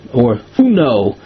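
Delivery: ordinary talk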